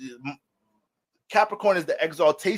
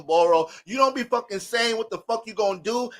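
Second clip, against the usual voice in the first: ordinary talk, nothing marked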